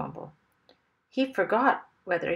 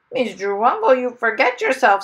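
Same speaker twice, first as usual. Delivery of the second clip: parrot voice; 4 semitones higher, 11 dB louder than usual. Acted.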